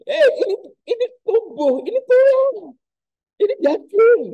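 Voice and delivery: very high, loud astonished gasps; amazed Thomas. Acted. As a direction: marvel at touching bone and flesh. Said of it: "lihat ekspresinya Thomas".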